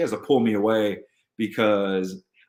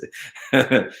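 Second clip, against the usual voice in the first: short laugh; 4 semitones higher, 3 dB louder than usual. Real, not acted. Laughs at his own notes.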